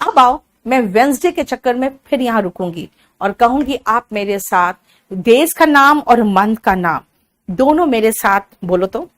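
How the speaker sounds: ordinary delivery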